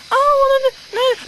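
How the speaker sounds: high, flustered mock coworker. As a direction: stammer out a weak refusal. Coworker Mark voiced in story.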